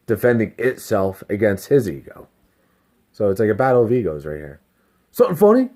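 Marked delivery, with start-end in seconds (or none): 5.1-5.7 high, loud challenge; imitated aggravation. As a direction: throw it like a guy picking a fight. Re-enacts the aggravated guy's challenge.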